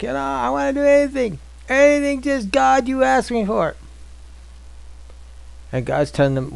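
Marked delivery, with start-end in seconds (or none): 0.0-3.8 high, mock-earnest promise; mock eager believer. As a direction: parody an eager churchgoer. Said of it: "people sitting there going like".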